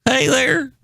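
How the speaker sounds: high put-on "hey there" greeting; new silly voice. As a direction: try out a goofy new voice. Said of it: "I'm trying some new voices".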